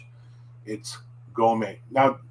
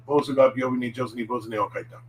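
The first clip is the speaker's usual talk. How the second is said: fast, fluent Japanese tongue twister; rapid tongue twister; much faster than usual. Shown as a demonstration. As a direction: rattle it off quickly. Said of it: "One was".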